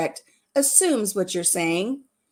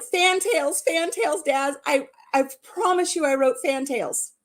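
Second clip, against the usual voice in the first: high-pitched, insistent retold correction; urgent protest; 5 semitones higher than usual. Hastily correcting a typo to Daz, urgent and pleading.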